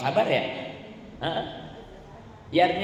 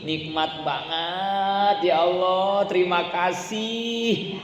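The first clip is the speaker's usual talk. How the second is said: slow, higher thankful drawl; grateful through pain; 3 semitones higher, much slower than usual. Shown as a demonstration. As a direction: savour the pain with gratitude. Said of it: "kalau kita bilang nikmat".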